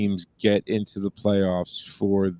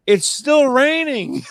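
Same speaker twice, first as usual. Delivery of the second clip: high, drawn-out mock wail; incredulous yell; 4 dB louder, slower than usual. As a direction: cry out in exaggerated disbelief, stretching the words. Incredulous reaction retold, drawn-out yell.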